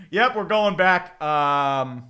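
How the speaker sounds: ordinary delivery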